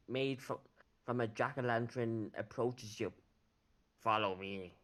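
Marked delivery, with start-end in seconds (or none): 4.0-4.7 lightly growled order from a bugbear; understated creature growl. Acted. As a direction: slightly lower bugbear growl. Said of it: "he growls".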